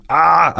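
short high yelp of pain; acted pain cry. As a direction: demonstrate a cry at breaking point. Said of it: "er et tegn på smerte".

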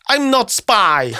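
put-on high, slow, stressed broken English with an Italian accent. Flustered coach explaining himself to a guard, thick Italian accent. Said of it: "servert med en nydelig italiensk aksent".

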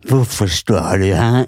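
slow, drawn-out hint of a menacing old man; slow mock old man. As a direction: drag out the question gruffly. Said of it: "Han var sånn". (treg, lett etterligning av skummel gubbe)

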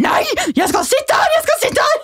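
hammed-up drunken protest, digging in; stubborn drunk yell. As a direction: refuse to budge, shouting like a drunk teen. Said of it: "jeg bare".

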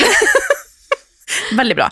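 said through a high, slow laugh; giggling through the words. Real, not acted. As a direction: laugh while praising it. Laughing mid-sentence.

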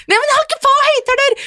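very high, loud outraged retort; indignant shout. Retold or imitated. Speaker snapping back at her partner on the phone, high indignant shout. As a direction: snap back, offended and shouting. Acted phone quarrel reply.

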